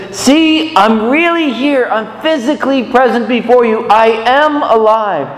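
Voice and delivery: high, insistent voice of the risen Jesus; risen Jesus speaking. Acted. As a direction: press them to believe he's truly here. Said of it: "and he says".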